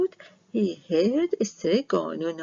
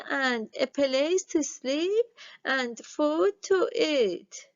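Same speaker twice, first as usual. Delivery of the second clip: put-on old witch giving orders; 6 semitones higher than usual. Strega Nona telling Big Anthony his chores in a higher, measured old-woman voice.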